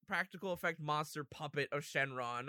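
usual speaking voice

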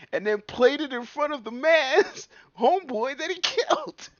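amused disbelief, laughing. Real, not acted. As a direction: crack up mid-sentence.

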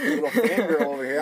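loud, high playful teasing. Real, not acted. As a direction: rib your buddy for being starstruck. Teasing friend about star photo.

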